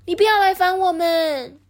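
high, loud cheeky piglet; high, loud defiant little pig. Acted. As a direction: shout back bravely at the wolf. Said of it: "托特里尼就说".

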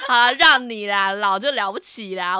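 sneering shout telling the elder to have their way; retold mocking shout. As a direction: taunt the old man loudly. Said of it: "我就一直这样讲".

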